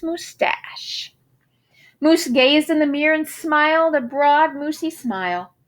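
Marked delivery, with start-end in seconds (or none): none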